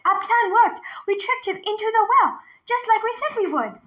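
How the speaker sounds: put-on high, proud girl's voice. A narrator's reading. Girl telling grandfather the plan worked, high and proud. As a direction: boast to grandpa.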